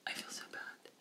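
hushed, voiceless confession; guilty whisper. Acted. Speaker acts out lingering guilt over a joke, whispering. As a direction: whisper it like a remorseful admission.

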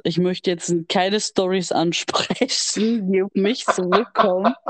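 ordinary talk

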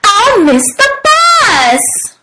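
high, slow wail of alarm; dismayed cry. A narrator's reading. Froggy fears missing the bus, dismayed cry. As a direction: wail it like a panicking child. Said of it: "he cried".

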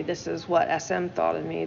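usual speaking voice

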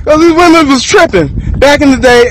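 ordinary delivery

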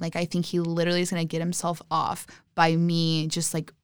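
ordinary talk, nothing marked

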